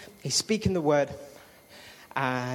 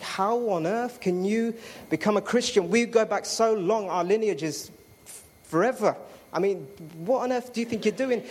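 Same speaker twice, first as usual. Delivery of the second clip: retold indignant protest, pitched higher; offended; 6 semitones higher than usual. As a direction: act out being offended, stretching the disbelieving questions. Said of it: "I got so offended".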